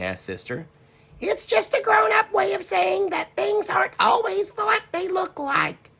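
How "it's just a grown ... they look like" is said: much higher, gentle mother bear voice; Mama Bear voice. Acted. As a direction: play the wise mother. Mama voice again.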